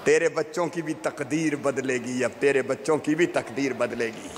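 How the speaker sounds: low, measured promise to the crowd; solemn promise. Real, not acted. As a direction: drop the pitch well down and pledge it earnestly. Solemn repeated promise to poor parents.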